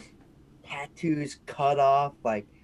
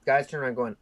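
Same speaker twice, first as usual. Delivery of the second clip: ordinary talk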